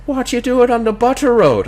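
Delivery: high, loud Irish brogue, imitating the local; mock the Irish mechanic's lilt; Irish tow man. Retelling the honeymoon tow man in a high Irish brogue.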